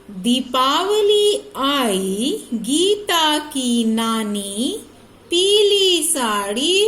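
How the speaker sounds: slow, clear classroom read-aloud; reading to children. Acted. Teacher reads the Diwali lesson slowly to her pupils.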